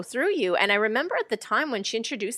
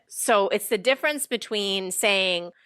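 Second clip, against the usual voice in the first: usual speaking voice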